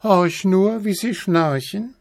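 high-pitched little cat voice; rufende Katze. A narrator's reading. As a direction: call it down from the treetop. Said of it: "rief die kleine Katze herunter". (hoch herunterrufendes Kätzchen)

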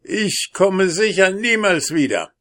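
high, loud dwarf voice, protesting; trapped dwarf crying out to be freed; cursing dwarf. A narrator's reading. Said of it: "fluchte der Zwerg".

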